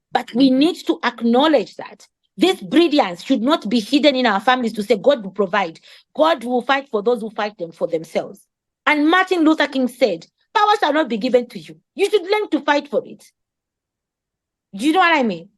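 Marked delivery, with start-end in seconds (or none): none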